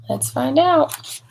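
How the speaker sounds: high, slow, playful tease; curious invitation. Real, not acted. Reader inviting the child to find Dumbo, slow and playful. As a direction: draw the listener into the mystery. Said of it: "reader's teasing aside".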